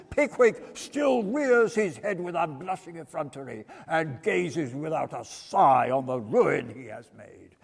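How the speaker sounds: grand booming courtroom speech, lightly voiced; pompous barrister's oration. A narrator's reading. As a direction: orate to the jury with mock outrage.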